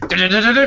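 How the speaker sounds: high, loud "du-du-du" music noise; imitated music restarting. Storyteller mimics diner music starting back up, high imitated tune. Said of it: "music starts back up".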